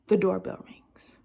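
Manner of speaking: breathy whispered reveal of the bell; dramatic whisper. Acted. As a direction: hush it like a suspense story. Suspense as the doorbell rings.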